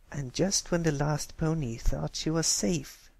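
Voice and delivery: a lightly voiced spooky storyteller; low creepy voice. Scootaloo telling her ghost story, lightly creepy. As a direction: tell it like a campfire horror tale. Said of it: "in a low, creepy voice".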